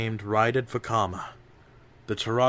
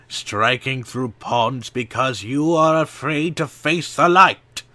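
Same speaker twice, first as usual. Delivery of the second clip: louder and higher, building to a yell; angry shout; 4 semitones higher, 8 dB louder than usual. A narrator's reading. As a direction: hurl the last word as an accusation. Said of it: "Vakama snapped".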